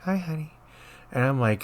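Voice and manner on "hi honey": put-on high, soft, syrupy little old lady; sweet old lady voice. Nurse imitating the patient sweet again next morning. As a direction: coo it like a sweet grandma. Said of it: "it was just like".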